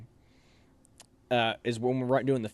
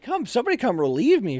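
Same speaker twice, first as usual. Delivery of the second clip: higher-pitched call for relief; acted-out fed-up appeal; exasperated plea; 9 semitones higher, 3 dB louder than usual. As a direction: beg the others for a hand. Acts out calling for relief.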